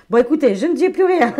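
parler en riant, plus haut et plus fort; rire nerveux. Real, not acted. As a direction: pouffer de rire et tenter de se reprendre. Fou rire en tirant les cartes. (fou rire, plus aigu et plus fort)

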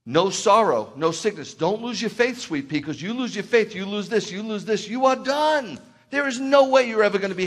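impassioned preaching. Acted. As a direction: preach it hard, building to a cry. Preaching builds to peak.

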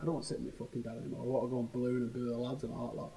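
fast, soft mock grumble of a lad; young lad. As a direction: mimic a twenty-something sick of sitting with dad. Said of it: "when you get to a certain age".

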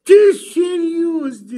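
sudden high shout; loud shout. Acted. A man answering a taunt with a loud high shout. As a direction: bark it out in mock disbelief. Mock outrage in a spat.

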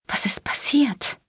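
slow, breathless whisper; a dazed whisper after waking from near death. A narrator's reading. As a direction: whisper it, still stunned. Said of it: "fragte ich noch völlig benommen".

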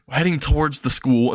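usual speaking voice

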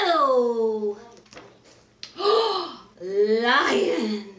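ordinary delivery

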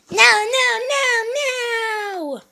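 high frantic crying no; panicked cry. Acted. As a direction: wail it in panic. Character sees robbers, panics.

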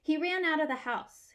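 plain reading voice

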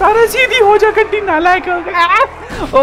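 high, drawn-out scolding; exasperated scolding. Real, not acted. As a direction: scold the useless car. Scolding the car.

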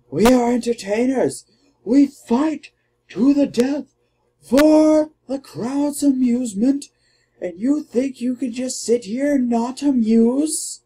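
a hint of a pompous arena fighter; mock lecture to a bored partner; indignant gladiator. Voicing the gladiator's reply.

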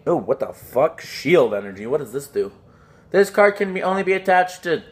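ordinary talk

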